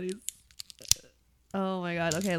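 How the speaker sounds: ordinary delivery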